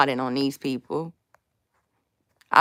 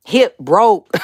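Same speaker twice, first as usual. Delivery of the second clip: loud laughing complaint; 3 semitones higher, 7 dB louder than usual. Real, not acted. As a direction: laugh it out, mock pain. Joking about being sore.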